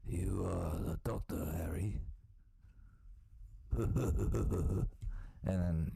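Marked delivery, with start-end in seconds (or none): 0.1-2.0 big man's announcement, lightly voiced; a hint of an imitated Korean giant; Korean Hagrid. Grandson telling grandfather's Hogwarts invitation, as Korean Hagrid. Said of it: "this big dude that looks like Hagrid, but he's Korean".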